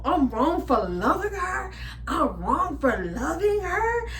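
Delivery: high, slow whiny plea; mock pleading ex. Vlogger mocking her stalker's begging, high and slow. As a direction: mimic him begging. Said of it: "he's like".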